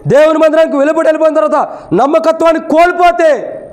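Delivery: high-pitched, insistent sermon delivery; preaching. Real, not acted.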